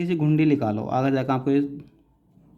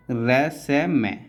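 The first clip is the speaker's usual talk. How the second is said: slow, spaced dictation of each stroke; syllable by syllable; much slower than usual. Shown as a demonstration. Teacher to student, spelling each stroke slowly. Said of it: "teacher spelling out shorthand strokes".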